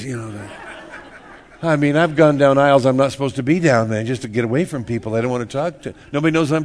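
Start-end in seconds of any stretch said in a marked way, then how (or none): none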